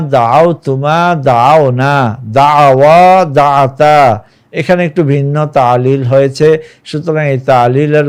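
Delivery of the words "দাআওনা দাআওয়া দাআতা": slow, deliberate Arabic recital; careful slow reading. Shown as a demonstration. Teacher reading Arabic verb forms slowly for students. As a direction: spell each form out carefully for students. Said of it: "মনোযোগ সহকারে ভালোভাবে পড়ব".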